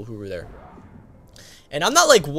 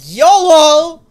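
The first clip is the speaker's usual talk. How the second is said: wild party yell; 18 dB louder than usual. Retold or imitated. Introvert at a party letting loose with a wild, high, loud yell.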